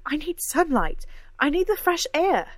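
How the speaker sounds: high, loud, emphatic demand; insistent outburst. Acted. Cora demands sunlight and fresh air, high insistent outburst.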